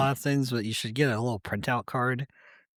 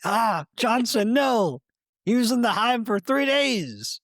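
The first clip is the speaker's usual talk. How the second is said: high, loud anguished mock shout; war-room commander; 11 semitones higher, 4 dB louder than usual. Cohost voicing bees mourning Johnson, melodramatic shouting. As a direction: cry out over a fallen comrade, hammed-up. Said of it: "I imagine a war room".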